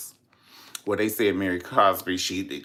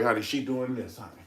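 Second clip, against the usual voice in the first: ordinary talk, nothing marked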